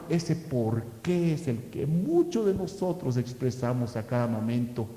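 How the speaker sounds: ordinary delivery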